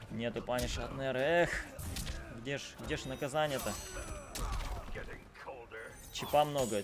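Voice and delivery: usual speaking voice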